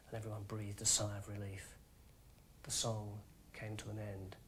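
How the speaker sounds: very low, very soft lull; soft hush. A narrator's reading. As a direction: settle the room with calm. Soothing harp again.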